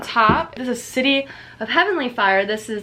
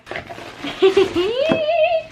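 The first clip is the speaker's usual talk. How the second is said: squealing laugh; high delighted laugh. Real, not acted. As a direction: laugh with giddy relief. Box opens, delighted.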